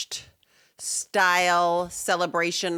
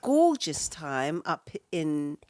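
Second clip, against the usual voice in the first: softer, slower put-on English accent; 3 semitones lower, 4 dB quieter, slower than usual. Co-host recapping a weekend at Ryan's, mock English accent. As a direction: draw it out in fake English. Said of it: "that's an English accent".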